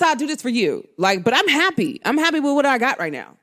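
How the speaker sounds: ordinary delivery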